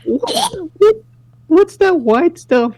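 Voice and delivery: high, slow, disgusted schoolkid; mocking kid voice. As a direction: drag it out like a grossed-out kid. S1 voices kids mocking yogurt.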